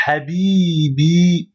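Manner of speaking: habibi with the final i stretched very long; long i held. Shown as a demonstration. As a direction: habibi slowed right down, holding the i. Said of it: "il faut prolonger le i". (habibi, le i final bien prolongé)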